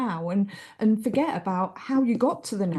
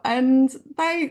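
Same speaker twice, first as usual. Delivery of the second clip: normal talking voice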